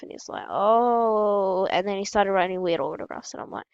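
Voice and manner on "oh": long, sustained "ohhh"; retold drawn-out oh. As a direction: stretch out a mock realisation. Retold player's drawn-out realisation.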